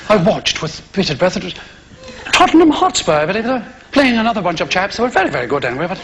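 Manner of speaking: affected English broadcaster, mostly low and quick; Nigel, radio preacher. Acted. Nigel telling listeners of his match, affected preacherly fashion. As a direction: parody a patronising religious radio talk. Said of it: "tell you all about God in this strange fashion".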